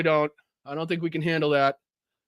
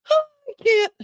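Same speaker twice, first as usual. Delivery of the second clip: laughing. Real, not acted. Laughing too hard to speak.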